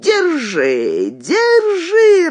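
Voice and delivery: drawn-out shout soaring high; крик вслед. A narrator's reading. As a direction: holler after them, stretching each word. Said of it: "раздался за нами крик". (протяжный крик, взлетающий вверх)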